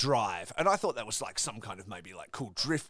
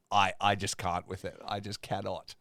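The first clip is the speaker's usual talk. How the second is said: usual speaking voice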